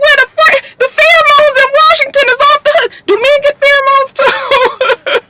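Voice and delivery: squealing high, laughing through it; shrieking with laughter at the kiss; high excited disbelief. Real, not acted. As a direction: react with giddy shock, voice way up. Shocked reaction to the kiss.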